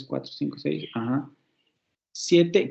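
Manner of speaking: ordinary delivery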